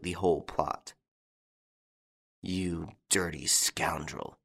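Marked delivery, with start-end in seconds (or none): 2.4-4.4 low muttered insult. A narrator's reading. Inspector mutters insult at Lupin, low mutter. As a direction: mutter it under your breath. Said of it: "he muttered".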